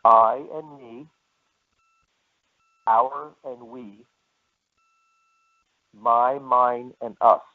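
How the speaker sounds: slowly, with long pauses between groups; slow dictation. Shown as a demonstration. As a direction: read out slowly for note-takers. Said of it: "I'll say them again slowly".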